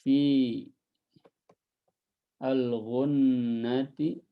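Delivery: drawn-out dictation chant; writing it on the board; slow recitation. Shown as a demonstration. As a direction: spell the verse out slowly. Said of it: "dictating verse while writing".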